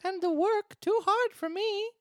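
put-on very high, loud puppet-boy voice; complaining Pinocchio. Pinocchio objecting to the bricklayer's job, high complaining voice. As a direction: whine like a workshy little puppet.

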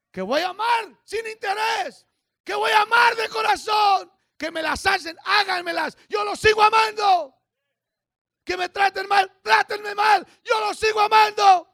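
high, loud preacher's shout; booming sermon declaration, pitched high; full-force preaching. Acted. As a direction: proclaim it loudly to the congregation.

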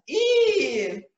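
high, loud disgusted shriek; shrill squeal. A narrator's reading. Donna shrieking with egg yolk in her hair, high and loud. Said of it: "schreit Donna und weint".

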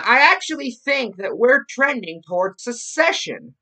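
high, loud mock-pundit voice; Rush Limbaugh parody. Podcast host reading a radio host's words, mocking how he speaks. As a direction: declare it loudly as a spoofed radio host. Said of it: "I am making fun of".

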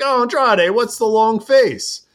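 high, lilting mock-sympathetic woman's voice; Lacey. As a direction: pitch it up and stretch the last words. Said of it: "And Lacey's like".

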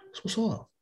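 high, soft mock eagerness; mock eager. Viewer perking up at side boob, mock eager. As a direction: perk up like a hopeful viewer. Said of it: "You're like".

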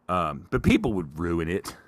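ordinary talk

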